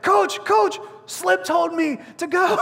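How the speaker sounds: high mock plea to the coach; pleading player. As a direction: imitate a player making excuses, pitched high. Scolded player pleads with his coach.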